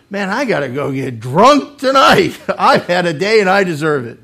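loud, hammed-up self-pitying bluster; would-be drinker. Man after a bad day claiming his drink, blustering. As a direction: announce it loudly, with relish.

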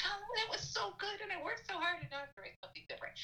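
high, whiny imitation of a frustrated student; mock whiny student. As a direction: play up the student's complaint, fading out fast at the end. Whiny student voiced in story.

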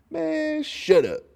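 high squeaky embarrassed shut up; embarrassed brush-off. Real, not acted. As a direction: brush off the praise, flustered. Bashful reply to compliments.

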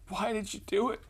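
high-pitched tearful girl imitation; mock a crying girl's plaintive question; squeaky, put-on weepy voice; imitated crying. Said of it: "and she's just crying".

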